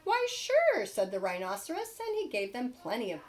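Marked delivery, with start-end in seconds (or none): 0.0-0.8 cheerful rhino reply. Acted.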